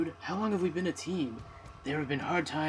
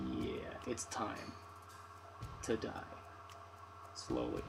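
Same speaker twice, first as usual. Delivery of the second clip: menacing whisper; 4 semitones lower, 14 dB quieter, slower than usual. Acted. Menacing villain line.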